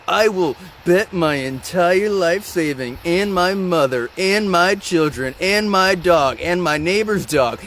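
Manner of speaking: hammed-up certainty, stretching each item of the list; mock overconfidence. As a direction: imitate an absurdly confident bettor, drawn out. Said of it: "extreme levels of confidence".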